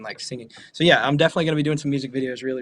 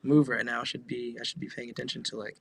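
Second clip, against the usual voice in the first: much softer anxious inner voice; self-conscious muttering; 2 semitones lower, 7 dB quieter than usual. Retold or imitated. Entertainer at the gate fretting to herself, much softer nervous inner voice. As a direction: worry quietly to yourself. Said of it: "I'm all self-conscious".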